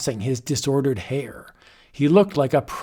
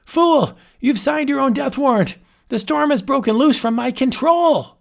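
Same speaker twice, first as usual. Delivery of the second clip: terrified croak; 11 semitones higher, 4 dB louder than usual. Acted. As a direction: wail in terror. Wizard in panic.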